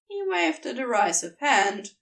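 plain reading voice

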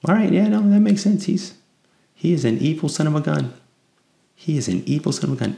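high sarcastic nodding along; mock realization. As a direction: play the obvious revelation for laughs. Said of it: "I'd be like".

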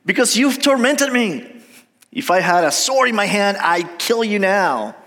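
high, emphatic storybook outburst; Balaam scolding his donkey. Acted. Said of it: "Balaam said to the donkey".

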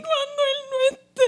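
high, wailing mock sob; mock crying. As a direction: ham up a tearful breakdown. Mock crying over dog.

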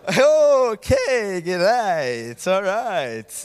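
loud, drawn-out mock acceptance; taken aback. Reacts to friend's blunt, painful remark. (overdrevet, langtrukkent og paff)

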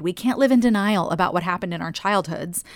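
ordinary delivery

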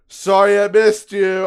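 loud, high mock apology; mocking note voice. Driver's missed-delivery note read out by angry customer, mocking. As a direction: taunt in a high, loud put-on voice.

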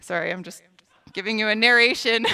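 ordinary talk

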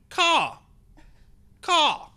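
high, loud crow call; crow caw. Acted. Caged crow facing the onlookers, cawing. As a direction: squawk it like a crow. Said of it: "in this weird bird-like way, and then he's going".

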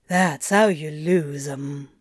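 low, understated sorrow; sad. A narrator's reading. Coworker telling Crowley he will lose clients, mildly sad. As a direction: say it low, with a touch of regret. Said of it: "Liga said sadly".

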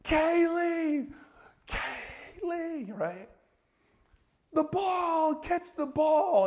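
high, loud parent yell across the field; screaming her name. Retold or imitated. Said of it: "And we start screaming".

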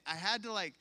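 ordinary talk, nothing marked

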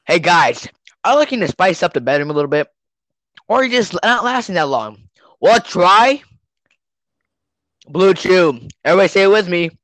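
loud, pushy infomercial pitch; cheesy ad read. Pretend. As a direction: hype it like a late-night commercial. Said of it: "we interrupt this podcast for another ad".

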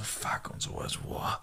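very soft, whispered curse; imitating a groggy near-whisper; dazed mumble. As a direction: curse faintly under your breath. Imitates dazed man helped up.

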